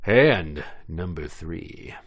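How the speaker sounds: slow dramatic announcement; drawn-out game call; slow announcer. Acted.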